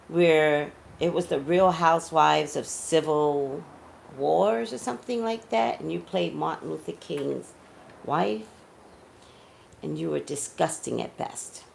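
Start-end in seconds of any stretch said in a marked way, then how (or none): none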